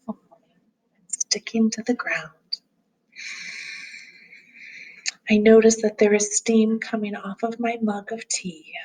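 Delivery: calm grounding exercise. Acted. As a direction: gently guide them through noticing.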